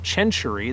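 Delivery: century said with an initial "ch", "chen-chury"; Italianized pronunciation. Shown as a demonstration. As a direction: start century with an Italian ch sound. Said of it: "I'm gonna pronounce it like an Italian".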